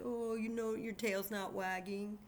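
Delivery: mild mopey sad-dog tone. Acted. Teacher showing how to act a sad dog, mildly mopey. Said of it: "like a sad dog with".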